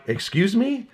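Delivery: high, questioning disbelief; taken aback. Acted. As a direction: react to a wildly improper invitation. Reply to shocking work text.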